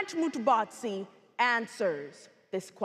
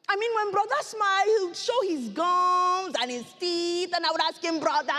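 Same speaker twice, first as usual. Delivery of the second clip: animated, higher-pitched dramatic reminiscence; genocide survivor; 5 semitones higher than usual. Acted. Survivor showing her family to the audience, fond storytelling.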